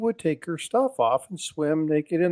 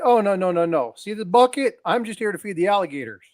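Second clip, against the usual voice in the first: innocent rancher; 6 semitones higher than usual. Retold or imitated. Rancher reassuring the lady.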